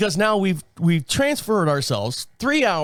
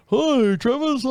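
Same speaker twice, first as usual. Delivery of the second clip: high, cheery mock polar bear; lightly voiced friendly polar bear; polar bear greeting; 8 semitones higher than usual. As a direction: wave hello like a cartoon bear. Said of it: "Polar bears are waving us, going".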